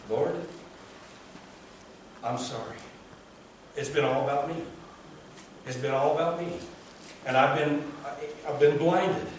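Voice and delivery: soft, low broken prayer; understated contrite prayer; quiet confession. Retold or imitated. Voicing David's confession.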